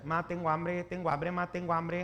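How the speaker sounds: put-on insistent repetitive kid plea; nagging children. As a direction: pester mom on a monotone loop. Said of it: "los hijos empiezan".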